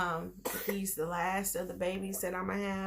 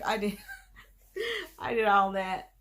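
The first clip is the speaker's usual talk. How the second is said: laughing; 5 dB louder than usual. Real, not acted. Laughing at her own excitement.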